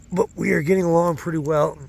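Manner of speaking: straining while picking up rocks; grunting with effort. Real, not acted. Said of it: "Sorry, I'm grunting".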